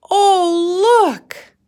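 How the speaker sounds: high, loud cry of delight; excited children. Acted. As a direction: kids spotting something amazing. Children exclaim in story.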